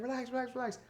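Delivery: high, mock soothing "relax"; host calming crowd. Host calming the waiting crowd, high sing-song. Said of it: "We're like".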